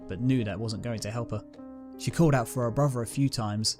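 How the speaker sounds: plain reading voice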